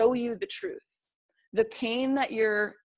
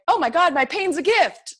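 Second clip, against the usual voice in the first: loud, high burst of excited revelation; excited exclamation; 9 semitones higher, 11 dB louder than usual. Acted. Coach voicing someone living a life of excitement, excited outburst. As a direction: exclaim it like a thrilled discovery, much louder and higher. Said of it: "a life of excitement, being like".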